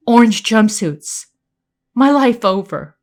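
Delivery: usual speaking voice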